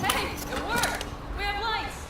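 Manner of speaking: high, happy exclamation without shouting; delighted. Acted. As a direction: call out your small victory, pitched high. Delighted the power came on.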